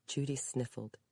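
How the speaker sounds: ordinary delivery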